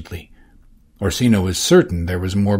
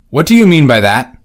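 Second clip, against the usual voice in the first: loud probing question; 5 semitones higher, 9 dB louder than usual. Acted. Orsino probing Johnson. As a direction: press him to explain. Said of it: "Orsino probed".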